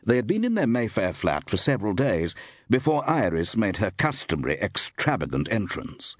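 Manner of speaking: plain reading voice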